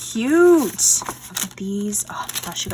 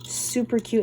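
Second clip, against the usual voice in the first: ordinary talk, nothing marked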